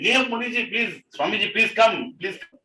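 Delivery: high, pleading call to someone running off; calling after someone. Acted. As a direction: beg them to come back. King calls after running sage.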